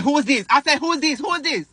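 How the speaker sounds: demanding. Retold or imitated. Demanding who is in car.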